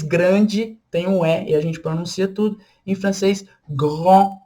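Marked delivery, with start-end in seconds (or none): 3.7-4.4 grand ending in a nasal vowel, no D; French pronunciation. Shown as a demonstration. Teacher giving the French word, silent final D. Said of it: "grand com um D no final".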